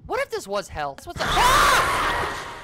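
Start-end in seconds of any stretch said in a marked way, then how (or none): 1.3-2.5 very high, loud shriek; startled scream. Real, not acted. Player hit by a jump scare, startled scream. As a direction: scream in sudden fright.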